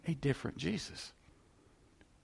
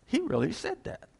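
high rising "he really said that"; disbelief; 11 semitones higher than usual. Retold or imitated. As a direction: gasp at the nerve of it. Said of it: "you're just kinda".

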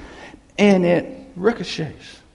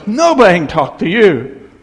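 loud, raised-pitch angry retort; fed-up husband; 4 semitones higher, 9 dB louder than usual. Acted. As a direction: act out a husband shouting back. Husband's retort in acted quarrel.

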